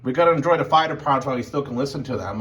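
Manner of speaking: ordinary delivery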